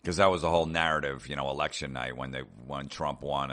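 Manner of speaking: ordinary talk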